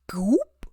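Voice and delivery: a breathy "goop" for the bullet; whispered gunshot noise. Retold or imitated. Imitating a gunshot hitting Will.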